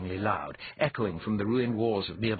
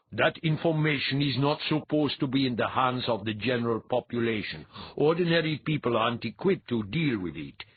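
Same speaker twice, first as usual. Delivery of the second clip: higher, stiffly indignant priest voice; indignant young priest; 4 semitones higher, 4 dB louder than usual. A narrator's reading. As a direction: lay down the rule as an offended priest.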